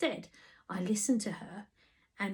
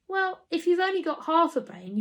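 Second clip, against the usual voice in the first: mocking jibe; 8 semitones higher, 5 dB louder than usual. A narrator's reading. Teasing the listener.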